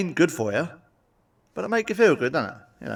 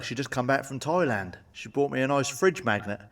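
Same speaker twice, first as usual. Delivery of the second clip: ordinary delivery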